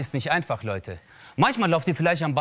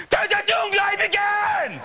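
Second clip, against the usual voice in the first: mock fanatic shout. The imagined hodja on the mosque steps, a very high hammed-up shout. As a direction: scream it like a cartoon hate preacher.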